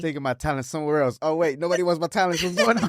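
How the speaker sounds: mock the guest's wounded pride; playful parody, rising in pitch. Host mocks guest's inner voice.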